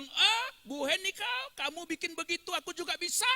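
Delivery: imagined churchgoer belittling Bu Heni; mocking boast. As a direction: mimic a scornful show-off. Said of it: "Jangan ada orang yang bilang".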